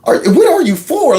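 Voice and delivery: high-pitched, incredulous questioning; imitated bafflement. Voicing the guest's natural reaction to the propagandists, baffled. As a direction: mimic someone who cannot make sense of this. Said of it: "she has a natural reaction".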